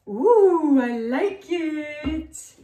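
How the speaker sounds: high, loud, drawn-out ooh of delight. Real, not acted. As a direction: gush over the colour slowly. Delighted at her paint colour.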